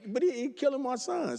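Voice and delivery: hammed-up outrage on his son's behalf; imitating a dad complaining about the ref; mock protest. Retold protest to other rep.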